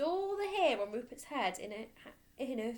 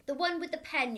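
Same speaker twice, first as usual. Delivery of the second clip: louder, higher snap; impatient headmistress; 9 semitones higher, 8 dB louder than usual. Acted. Headmistress answering the boy, loud and impatient.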